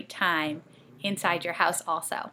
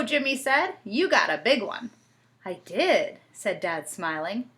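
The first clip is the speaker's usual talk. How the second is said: lively storybook reading with voices; reading to children; 2 semitones higher, 5 dB louder than usual. A narrator's reading. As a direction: read it like a bedtime story.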